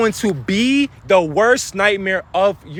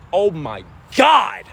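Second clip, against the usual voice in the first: stunned oh my god; much slower than usual. Real, not acted. Overwhelmed by his own point.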